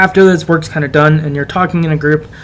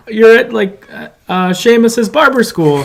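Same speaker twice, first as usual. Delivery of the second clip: silly mock Irish accent, pitched higher and drawn out slowly; fake Irish accent; 6 semitones higher, slower than usual. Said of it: "like, fake Irish, uh, accent".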